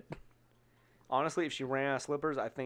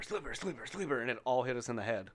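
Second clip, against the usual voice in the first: ordinary talk, nothing marked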